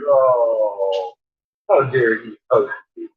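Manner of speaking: normal talking voice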